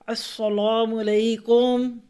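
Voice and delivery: slow, loud, showy Arabic-style salaam; pompous drawn-out greeting. Retold or imitated. Mocking a new Tajweed learner, slow showy salaam. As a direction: stretch the greeting out to sound learned. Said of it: "ये tone बनती है".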